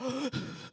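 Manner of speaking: high startled gasp; a shocked gasp; sharp gasp. Retold or imitated. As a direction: suck in air in shock. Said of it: "I'm like".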